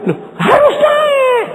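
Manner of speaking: high, slow, tearful shout; a husband pleading with his crying wife to climb; near-crying shout. Retold or imitated. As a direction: cry out in distress, slowly and high. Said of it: "aku bilang".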